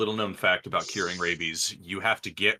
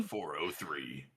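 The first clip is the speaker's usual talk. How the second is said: soft whispered error readout; whispering; 6 semitones lower, 12 dB quieter, slower than usual. Pretend. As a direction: breathe it like a glitching machine. Mock robotic error message.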